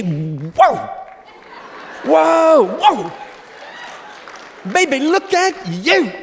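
loud, high, excited exclamation; bowled over by his wife's looks; delighted whoa. Retold or imitated. Husband wowing his wife before prayer meeting, excited. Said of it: "leap to your feet and say".

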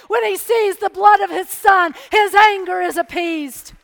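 full-force preaching. Acted. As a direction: preach it, build toward the answer.

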